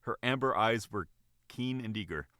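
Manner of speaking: ordinary delivery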